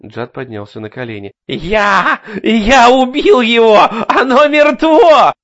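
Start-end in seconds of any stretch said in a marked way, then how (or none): 1.5-5.3 high, slow frantic cry; hysterical shouting. A narrator's reading. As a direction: shriek it in shock and triumph. Larry hysterical after killing. (истерический крик)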